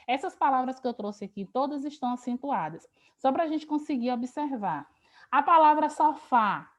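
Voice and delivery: ordinary talk, nothing marked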